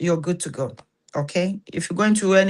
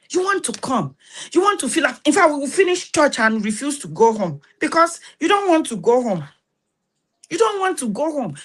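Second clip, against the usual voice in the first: excited pleading; 7 semitones higher than usual. Real, not acted. Passionate pitch about church.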